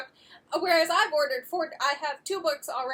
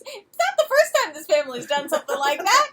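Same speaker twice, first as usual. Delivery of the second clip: higher, louder, through laughter; dad's laughing shrug; 9 semitones higher, 9 dB louder than usual. Retold or imitated. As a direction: wave it off, amused. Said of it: "And then he goes".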